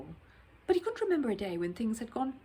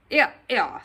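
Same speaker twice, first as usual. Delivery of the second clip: sharp, loud "here, here" to stop him; loud rebuke; 3 semitones higher, 9 dB louder than usual. Acted. Gatekeeper scolding the bear, loud and sharp. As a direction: gatekeeper calling the bear to order.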